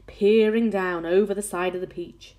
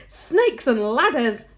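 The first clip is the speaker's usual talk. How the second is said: loud, slow, higher exclamation; horrified yell; 5 semitones higher, 7 dB louder, slower than usual. A narrator's reading. Fire chief seeing the spider's head, horrified yell. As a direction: yell the oath, stretching each word. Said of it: "yelled the head of the fire department".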